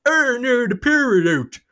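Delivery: high, mock-dramatic funny voice; silly voice. Father to kids in the blackout, high silly voice. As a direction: ham up the power cut. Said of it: "I was going".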